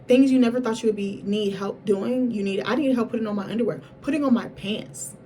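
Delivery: ordinary delivery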